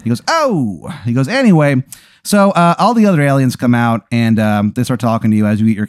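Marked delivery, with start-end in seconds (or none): 0.3-0.9 put-on Italian accent. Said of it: "he talks in an Italian accent". 1.3-1.8 put-on Italian accent. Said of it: "he talks in an Italian accent".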